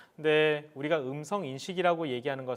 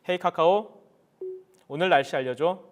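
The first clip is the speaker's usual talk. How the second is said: talking to voice assistant; 3 semitones higher, 7 dB louder than usual. Real, not acted. Commanding smart speaker.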